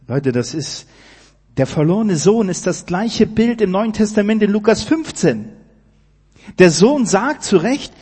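hoch und laut, mit leidenschaftlichem Predigerschwung; Predigt mit voller Kraft. Acted. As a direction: ruf die Gnade Gottes begeistert in den Saal. Preaching at full force. (feurig predigend, hoch und laut)

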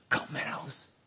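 soft, unvoiced "Komm heraus"; whispered call. The Lord calling the sinner out, whispered "Komm heraus".

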